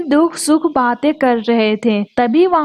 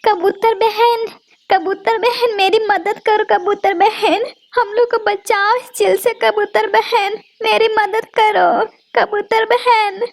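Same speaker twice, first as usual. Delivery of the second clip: calling for help; 7 semitones higher than usual. Acted. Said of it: "जोर जोर से चिल्लाने लगती है".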